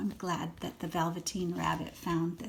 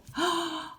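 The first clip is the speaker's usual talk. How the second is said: gasp; 10 semitones higher, 3 dB louder than usual. Acted. Gasping at the boy's words.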